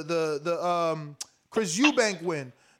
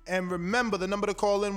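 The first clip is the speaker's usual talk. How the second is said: ordinary talk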